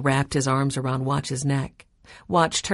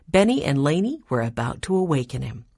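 ordinary delivery

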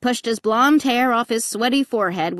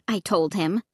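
usual speaking voice

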